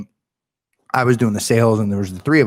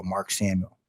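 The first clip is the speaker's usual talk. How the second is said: ordinary delivery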